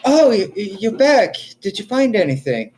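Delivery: high-pitched, stammering surprise; flustered desk clerk. Acted. Clerk greets the returning party, high and flustered. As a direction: look up startled, voice lifted high. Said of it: "Gilgris writing out some papers".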